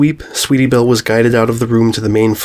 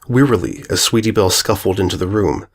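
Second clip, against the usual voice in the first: usual speaking voice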